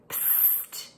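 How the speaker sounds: unvoiced hissed psst; whispered psst. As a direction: call softly through the window. Whispered call to friend outside.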